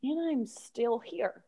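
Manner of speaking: very soft, unhurried reassurance; softly surprised to still be here. Acted. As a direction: drop to a quiet, accepting voice. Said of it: "shift completely your voice and your character".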